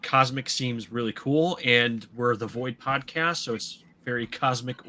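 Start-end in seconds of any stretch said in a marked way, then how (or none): none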